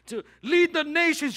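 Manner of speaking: high, loud proclaiming voice; thundering call from above; booming divine call. Retold or imitated. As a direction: announce it like heaven calling down. Voicing God's call to him.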